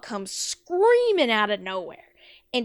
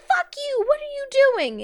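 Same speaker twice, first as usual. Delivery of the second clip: very high, angry screech; hammed-up outrage; mean mother; 6 dB louder than usual. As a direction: lash out high-pitched as the mom. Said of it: "Man, she got mean quick".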